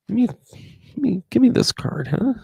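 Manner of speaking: mock pleading. Asking for a card.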